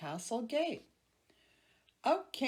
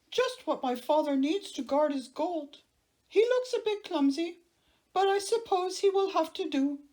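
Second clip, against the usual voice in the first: prince voice; 9 semitones higher, 8 dB louder than usual. Acted. The prince speaks.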